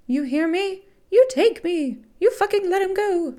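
high, loud furious demands; screaming. A narrator's reading. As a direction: scream to force the attacker to let go. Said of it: "her screams were beginning to merge".

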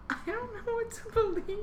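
very high, put-on helpless wail; mock despair. Podcast host despairing over the show, mock despair. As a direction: wail it high, playing the lost fan.

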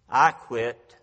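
ordinary talk, nothing marked

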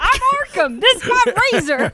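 high, loud mock philosopher; booming grand character voice; Orkham voice. Jokey Orkham impression.

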